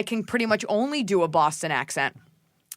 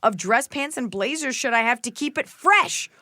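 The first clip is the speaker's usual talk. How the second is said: higher, hammed-up reading of a letter; dramatic question read-out; 4 semitones higher than usual. Co-host reading a listener's wardrobe question aloud, hammed up. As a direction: read it out with big emphatic swoops.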